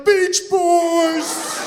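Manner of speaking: high, loud, drawn-out holler; yelled. Retold or imitated. Boys posing for the Polaroid, gleeful mock yell. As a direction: yell it like an overexcited kid. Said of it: "We even yelled".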